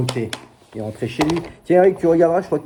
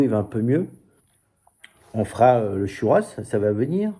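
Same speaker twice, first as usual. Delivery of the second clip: normal talking voice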